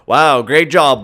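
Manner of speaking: ordinary talk, nothing marked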